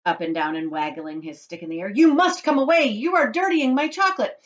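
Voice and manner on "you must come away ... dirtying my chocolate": high, loud, frantic order; crying out. A narrator's reading. Mister Wonka protecting his chocolate, crying out. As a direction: protest urgently at raised pitch. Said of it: "cried Mister Wonka".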